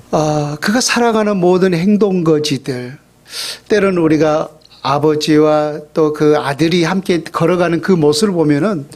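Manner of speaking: normal talking voice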